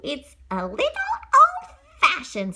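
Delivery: high, slow chirpy bird voice. Acted. Birds doubting the old dress, high and slow. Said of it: "said the birds".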